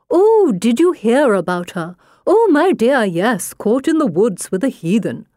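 high, prissy gossiping lady; prim society matron. Retold or imitated. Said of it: "He mimics the high, prim voice of a society matron".

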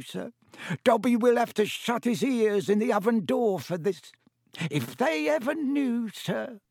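high, squeaky, dreading servant; Dobby, fearful elf. A narrator's reading. Elf dreads his family finding out, fearful. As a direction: squeak with alarm at being found out.

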